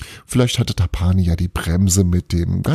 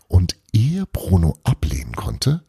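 ordinary delivery, nothing marked

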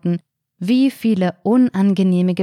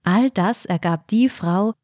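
plain reading voice

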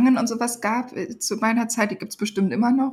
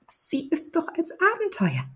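mother's voice, higher and softer, smiling; 8 semitones higher, 7 dB quieter than usual. Acted. Mother smiling to convince Benno it's an adventure. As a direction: sell it as a fun adventure. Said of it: "lächelte meine Mutter".

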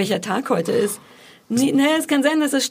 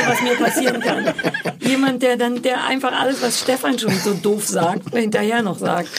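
usual speaking voice

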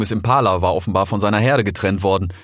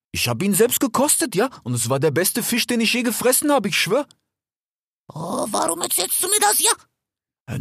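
put-on a slightly higher, friendly young fox; lightly voiced fox; 13 semitones higher than usual. A narrator's reading. Desert fox talking to his brother Rix, lightly voiced. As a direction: play the eager desert fox. Fennec voice.

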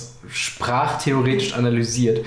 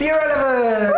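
high, hyped-up show title; excited; 13 semitones higher than usual. Acted. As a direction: announce it like a party host. Podcast intro jingle shout.

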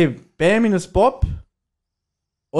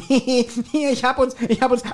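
mock flustered squeals, higher; hammed-up high excited voice; 9 semitones higher than usual. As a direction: play it over the top, juggling hot mugs. Acting out Peter with hot punch.

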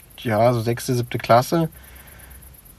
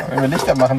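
usual speaking voice

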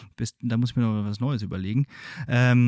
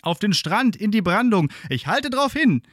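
resolute call; 8 semitones higher, 3 dB louder than usual. Acted. Helmsman answers through the smoke.

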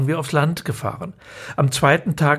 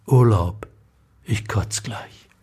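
whispered punchline; 5 semitones lower, slower than usual. Acted. The narrator closes the story to the audience with a slow whisper. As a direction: whisper the last line, deadpan.

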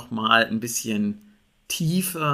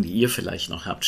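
usual speaking voice

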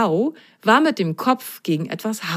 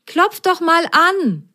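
suggesting; 12 semitones higher than usual. Acted. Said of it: "schlug Apfelgrün vor".

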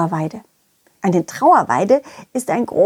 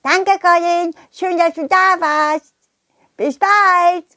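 high, cheerful little creature; high Schwubi voice; 8 semitones higher, slower than usual. Acted. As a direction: wave goodbye as a little Schwubi. Schwubis say goodbye.

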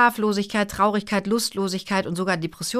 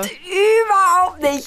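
high, drawn-out cry of denial; wailing protest; 10 semitones higher, much slower than usual. Real, not acted. Mother denying daughter's mocking imitation, high wailing protest. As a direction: wail it out slowly in protest.

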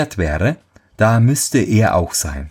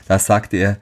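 usual speaking voice

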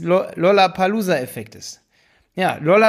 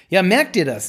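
ordinary delivery

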